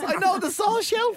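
high, lightly voiced know-it-all; mock worker voice. Quoting the man at Ipswich Bunnings, high and proud.

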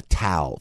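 "towel" squeezed into one syllable; South Jersey pronunciation. Shown as a demonstration. Host showing South Jersey speech, "towel" as "tal". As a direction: say "towel" as "tal", Philadelphia style. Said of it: "the South Jersey, uh, Philadelphia kind".

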